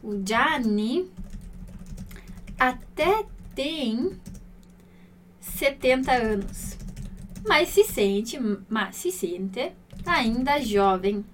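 very slow, word by word with long pauses; slow, spaced reading. Shown as a demonstration. Teacher translating for students, slow and spaced. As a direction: lay out each phrase slowly for the learner. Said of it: "Pra ficar mais literal aqui".

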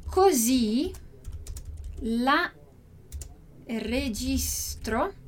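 slow Italian, each word spaced out; teacher spelling out the phrase word by word; slow, syllable by syllable. Shown as a demonstration. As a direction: dictate it slowly for students. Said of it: "Esse lá aqui a gente poderia escrever".

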